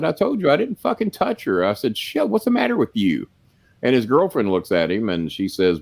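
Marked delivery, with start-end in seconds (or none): 0.0-1.6 retold high-pitched exasperation; exasperated protest. Retold quarrel, protesting innocence. 2.0-3.3 retold indignant high-pitched challenge; exasperated. Retold quarrel, angry question.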